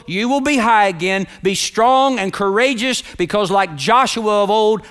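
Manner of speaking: high, building preacher's proclamation, ringing out over the congregation; full-throated, rhythmic sermon climax; impassioned preaching. Real, not acted. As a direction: preach it with rising force, punching key words.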